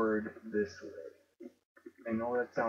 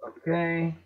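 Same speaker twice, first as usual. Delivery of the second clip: ordinary talk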